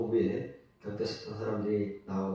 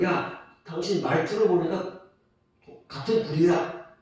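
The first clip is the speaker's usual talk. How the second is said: higher, louder accusing call of "야, 당신 말투를 보니까"; accusing bystander; 6 semitones higher, 5 dB louder than usual. Acted. Preacher voices the crowd accusing Peter, sharply pointed.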